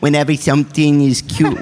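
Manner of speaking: ordinary talk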